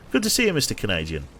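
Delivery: ordinary delivery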